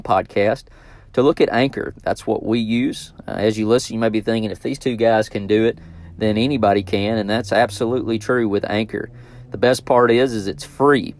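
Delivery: host reading the Anchor promo to listeners; podcast ad read. Acted. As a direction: sell it straight to the listener. Sponsor ad read.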